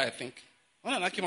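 usual speaking voice

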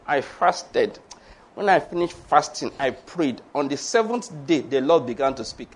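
low, soft pious testimony; mock self-righteous. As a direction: imitate a solemn, self-important testimony. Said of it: "this self-righteousness".

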